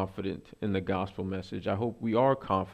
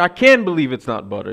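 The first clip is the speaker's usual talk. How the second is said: loud, indignant retold protest; hammed-up exasperation, raised and loud; mock outraged outburst; 3 semitones higher, 10 dB louder than usual. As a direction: act out blurting the slogan in disgust. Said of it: "he storms out".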